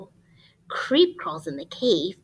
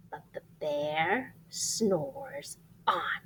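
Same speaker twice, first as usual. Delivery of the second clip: whisper; 6 semitones lower, 9 dB quieter, slower than usual. Acted. Refrain whispered over sleeping bear.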